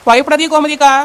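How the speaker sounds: loud reassurance; shouting back. Acted. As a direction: call back to calm her. Shouting reassurance across flood. (சத்தமான ஆறுதல்)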